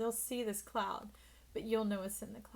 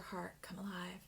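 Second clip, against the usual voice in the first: whisper; 4 semitones lower, 11 dB quieter than usual. Real, not acted. Tarot reader drops to a whisper.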